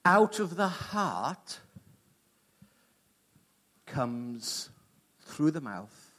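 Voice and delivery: solemn preaching. Acted.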